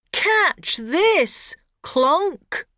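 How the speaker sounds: put-on high boy shout. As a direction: call out playfully. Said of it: "shouts Ben".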